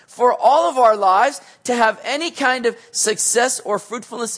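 full-throated sermon peak; preaching at full force. Acted. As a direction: drive the point home to the congregation. Pastor exhorts the congregation.

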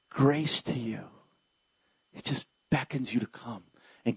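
low, soft, hushed preaching; quiet, intimate closing appeal; tender invitation. Real, not acted.

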